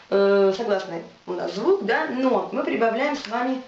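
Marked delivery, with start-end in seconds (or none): none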